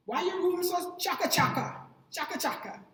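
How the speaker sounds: mock-scolding Jamaican dad. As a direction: tell off the kid about the messy room. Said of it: "my dad would say".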